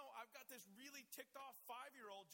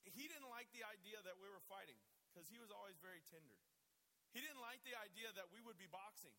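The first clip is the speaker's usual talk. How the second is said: usual speaking voice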